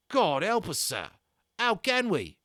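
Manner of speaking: put-on high, loud old soldier griping about the damage; a rattled army corporal complaining to his officer; British army corporal. Corporal grumbling to young Omri about ruined weapons, loud and indignant.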